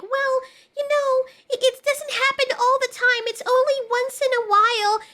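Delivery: mocking imitation. Mocking viewers who defend the show, in a high whiny voice. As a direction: whine it high to mock the people defending the show. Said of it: "people are gonna probably think".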